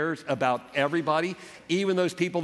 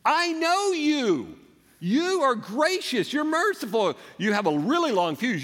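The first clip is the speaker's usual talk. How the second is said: accusing Jonah; 2 semitones higher than usual. Acted. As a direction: throw God's kindness back at him like a charge. Jonah accusing God in prayer.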